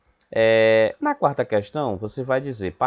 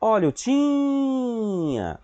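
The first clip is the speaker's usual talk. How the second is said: high, long drawn-out vendor cry; street vendor call; 10 semitones higher, much slower than usual. Shown as a demonstration. Teacher showing pupils the stressed syllable, sung-out vendor call. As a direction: hawk it like a street seller, stretching TI in "tinha". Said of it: "faz o vendedor".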